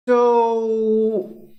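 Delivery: loud, high, drawn-out "so". Real, not acted. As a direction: let it burst out high and loud, holding the vowel. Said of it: "oh, that was loud".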